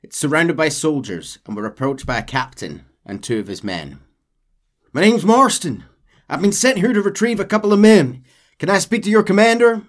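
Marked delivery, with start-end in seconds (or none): 4.9-9.8 loud, high Marston voice; John Marston firmly asking for the commander; gruff cowboy. Acted. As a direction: play the cowboy demanding to see the boss. Voicing Marston to the captain.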